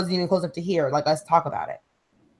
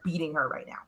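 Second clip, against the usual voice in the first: hushed retold whisper; 3 semitones lower, 13 dB quieter, faster than usual. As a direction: drop to a whisper as if not to be overheard. Housekeepers reporting the beating.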